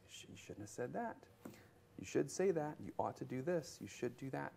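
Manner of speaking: soft, quick nagging inner voice; yappy inner voice. Retold or imitated. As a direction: nag under your breath like an inner critic. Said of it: "that little yappy voice".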